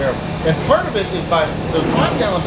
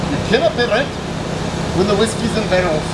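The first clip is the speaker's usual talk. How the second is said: a second try at a Scottish accent, a bit higher; retry the hammy Scottish accent, a little closer; Scottish accent again; 5 semitones higher than usual. Shown as a demonstration. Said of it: "Try that again".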